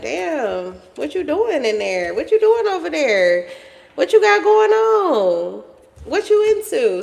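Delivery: mock-nosy, pitch climbing high with each question; nosy curiosity. Onlooker peeking in at you, nosily curious. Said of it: "Somebody's very curious about you".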